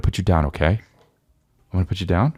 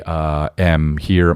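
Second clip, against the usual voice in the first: normal talking voice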